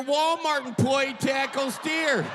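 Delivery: ordinary talk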